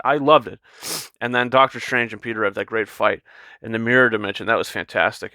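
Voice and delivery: normal talking voice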